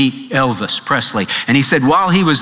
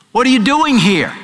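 preaching emphasis; 9 semitones higher than usual. Real, not acted. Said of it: "preacher repeats with force".

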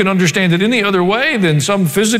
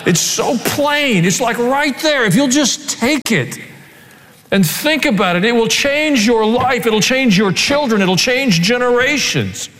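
higher, slow and emphatic pleading; fervent exhortation; 6 semitones higher, slower than usual. Real, not acted. Preacher urges the congregation to take Proverbs seriously, fervent. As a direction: drive it home with passion.

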